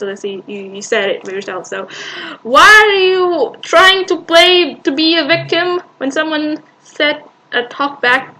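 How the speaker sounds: ordinary talk, nothing marked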